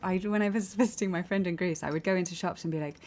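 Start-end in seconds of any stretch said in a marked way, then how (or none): none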